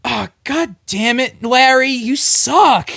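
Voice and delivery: slow, high, loud groaning complaint; hammed-up heckling; mock disappointed crowd. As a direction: drag it out like a let-down mob. Crowd groaning at failed sacrifice.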